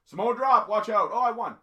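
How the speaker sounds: hammed-up ringside yell, high and loud; mock hype shout. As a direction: belt it out like a cheesy wrestler. Mocking a wrestler's signature move.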